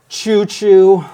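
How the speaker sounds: loud high train whistle call; train choo choo. Pretend. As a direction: belt it like a train horn. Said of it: "are you saying choo choo like a train".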